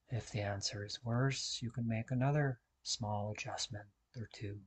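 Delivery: very soft, calm meditation voice; guided meditation. Real, not acted. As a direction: guide them into stillness, hushed, with long pauses. Leads a seated meditation.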